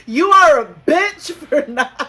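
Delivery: high, slow hammed-up accusation; mock insult. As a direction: draw out the insult, close to laughing. Acted insult amid laughter.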